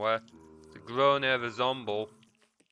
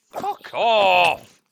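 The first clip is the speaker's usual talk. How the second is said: loud angry yell at the mob; angry shout; 6 semitones higher, 11 dB louder than usual. Real, not acted. Shouting at a mob in the game, angry. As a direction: bark it out, fed up.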